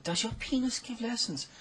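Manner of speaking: put-on high girlish repeat of the question; teen girl voice. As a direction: ask it again, innocent and high. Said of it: "And she said".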